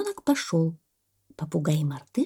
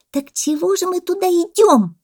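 high, loud squeaky protest; indignant monkey; 13 semitones higher, 8 dB louder than usual. A narrator's reading. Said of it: "возмутилась мартышка". (возмущённая мартышка)

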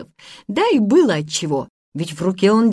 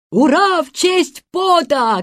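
high, slow drawn-out hurrah; cheering; 10 semitones higher, 3 dB louder than usual. A narrator's reading. Boys cry in one voice, cheering. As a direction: cheer it out like a crowd. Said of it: "крикнули мальчики в один голос".

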